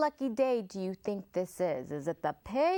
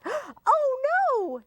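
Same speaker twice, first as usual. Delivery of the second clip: very high squealing scream; piglet scream; 7 dB louder than usual. Acted. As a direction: shriek in piglet panic. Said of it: "screamed the piglet".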